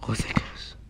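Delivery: scared. Real, not acted. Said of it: "I'm so scared".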